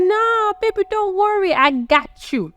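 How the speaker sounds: put-on high-pitched girlfriend voice; put-on high voice, soothing her boyfriend; doting girlfriend impression. Voicing the girlfriend reassuring Chris.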